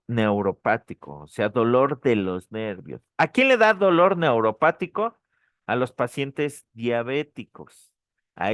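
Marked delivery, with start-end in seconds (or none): 3.2-5.2 quizzing question. Real, not acted. Teacher quizzes the class.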